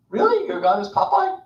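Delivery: a jokey high sneer at Moses; mock Pharaoh as Popeye. Pharaoh scoffing at Moses's God, squeaky and high. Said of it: "you knew I was going to".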